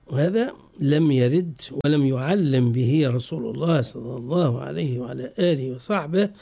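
ordinary talk

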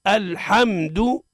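الحمد very slowly, "al-hamm-du", no vowel after م; deliberate model reading of الحمد, meem unbounced; still, held meem. Shown as a demonstration. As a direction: hold the closed m in الحمد. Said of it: "لها ضوابط".

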